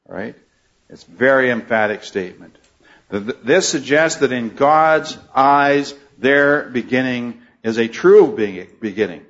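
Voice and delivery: usual speaking voice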